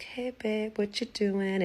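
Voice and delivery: high, innocent-sounding phone greeting; feigned casualness. Retold or imitated. Suspicious woman calls her man, mock-casual greeting. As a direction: act as if just checking in.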